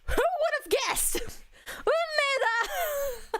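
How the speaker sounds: high and breaking into a laugh; gleeful fan cry. Retold or imitated. Fan realising she knew him since his debut, giddy laughing cry. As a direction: shriek the name in giddy disbelief. Retold amazed fan outburst.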